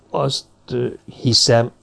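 halting, with a filled pause before "hiszem"; hesitant. A narrator's reading. Zombori answers the woman's invitation, hesitant. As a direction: break "Azt, ö, hiszem" up with uncertain pauses.

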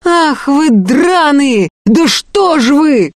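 high, drawn-out furious cry; stretched, high-pitched outrage; outraged yell. Acted. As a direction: burst out in indignation. Yefim yells at the women.

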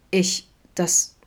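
stumbling, caught-off-guard words; falter, lost for words; stammering. A narrator's reading. Vincent stammers, shocked by Adam's outburst. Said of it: "stammelte Vincent".

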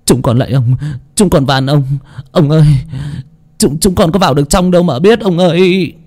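a touch of high, pleading servants; begging. Acted. Servants begging their master to stop beating them, mildly high. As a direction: plead for mercy, mildly rising. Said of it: "van xin".

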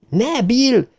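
high-pitched cry to stop him; screamed plea. A narrator's reading. Beverly begs Bill to stop, screaming. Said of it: "sikoltotta Beverly".

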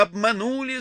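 high, thin wail; thin, obnoxious cry. A narrator's reading. She cries that she was deceived, thin wail. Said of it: "закричала она неожиданно тонким и противным голосом".

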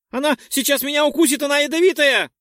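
high, loud, fast panicked shriek; hysterical fear. Acted. Dex fears the bug will bite him, hysterical shriek. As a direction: shriek it out in terror.

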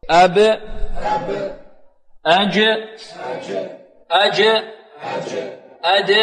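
isolated syllables "أب", "أج", "أد", each ending in a short echo; qalqala drill. Shown as a demonstration. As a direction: release the final consonant of "أب", "أج", "أد" with a bounce. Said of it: "انطقوا معي". (تدريب القلقلة في "أب" و"أج" و"أد")

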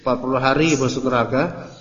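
ordinary talk

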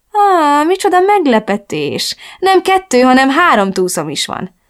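high, mock-delighted surprise; gloating. A narrator's reading. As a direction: savour it like a pleased villain. Villain gloats over new hostage. (kárörvendő, gúnyos meglepődéssel)

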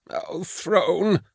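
high-pitched, muted wail of fright; frightened cry. A narrator's reading. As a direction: cry out in panic without volume. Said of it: "Karl cried out".

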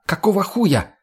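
high, indignant demand; acted exasperated outburst; angry roar. A narrator's reading. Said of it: "взревела она".

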